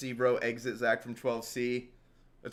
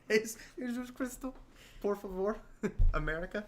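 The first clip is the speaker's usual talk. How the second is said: higher, laughing mock Mexican accent; Spanish accent; 7 semitones higher than usual. Friend joins the Mexican Jesus joke, high laughing Spanish accent. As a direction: ham it up as Mexican Jesus begging, giggling.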